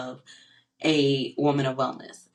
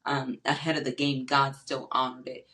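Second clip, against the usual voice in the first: ordinary talk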